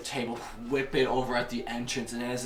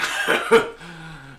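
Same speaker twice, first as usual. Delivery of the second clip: loud, higher acted laugh; villain's laugh; 7 semitones higher, 12 dB louder than usual. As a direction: laugh over the fresh kill. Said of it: "he just".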